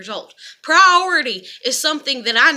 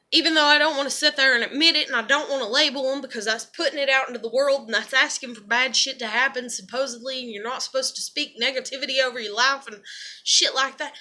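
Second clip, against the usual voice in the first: ordinary talk, nothing marked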